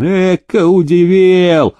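high, drawn-out mock amazement; amused, unimpressed drawl; smiling scoff. A narrator's reading. Demidov unimpressed by the nobleman's boast, smiling scoff. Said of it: "улыбнулся Демидов".

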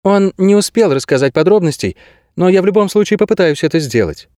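put-on high-pitched young heroine; voiced girl character. A narrator's reading. Narrator voices the girl Kase.

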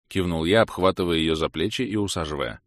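usual speaking voice

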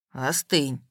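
a low, curt brotherly check; curt rebuke. A narrator's reading. Егор tells off his sister: low curt rebuke. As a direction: cut her off low and short. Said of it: "одёрнул сестру Егор".

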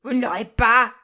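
loud, exultant cry; triumphant shout. A narrator's reading. Delirious miser gloats at his enemies, shouting. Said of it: "cria-t-il".

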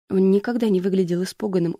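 ordinary delivery, nothing marked

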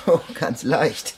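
slow, amused, laughing reply. Acted. Henri teases his grateful friend, laughing. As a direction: laugh it off kindly.

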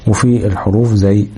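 ordinary delivery

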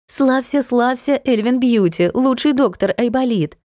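high mock hymn of praise; sarcastic. A narrator's reading. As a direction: sing its praises with a sneer. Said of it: "съязвила Натка". (язвительно, высоким голосом)

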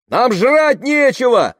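very high, loud, drawn-out cry; desperate shout. A narrator's reading. Hungry woman crying out at Tamara, shouted. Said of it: "крикнула женщина".

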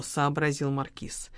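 ordinary delivery, nothing marked

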